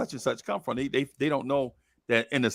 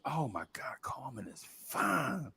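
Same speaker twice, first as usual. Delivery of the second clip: soft breathy whisper of admiration; awed whisper; 12 dB quieter, slower than usual. Retold or imitated. Boy seeing Carmen, awed whisper. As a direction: whisper it like you're smitten.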